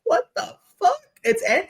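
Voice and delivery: a sudden high, cracking cry of disbelief; broken-down Cleopatra. Retold or imitated. Cleopatra breaking down over her lost empire, high anguished cry. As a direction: shriek it as a queen whose world is collapsing. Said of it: "she's like".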